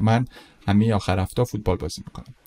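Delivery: ordinary talk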